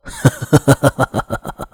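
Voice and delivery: a chilling amused laugh; laugh, but with menace under it; cold laugh. Acted. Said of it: "但他居然笑了，还笑得很开心".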